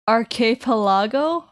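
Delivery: archipelago as ar-kay-puh-LAH-go, rising; unsure pronunciation. Shown as a demonstration. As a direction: say archipelago with "kay" in the second syllable. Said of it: "I'm saying archipelago wrong".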